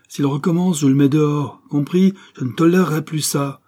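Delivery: loud and high, furious ultimatum; a husband laying down the law in a rage; angry threat. A narrator's reading. Husband threatens to throw brother out.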